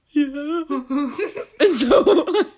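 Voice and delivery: high, laughing, near-crying voice. Real, not acted. Tearful retold answer to coach.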